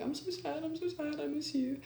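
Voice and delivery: high, soft mock-needy whine; lightly imitated sad pleading; imitating tearful pleading. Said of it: "calling them crying all the time".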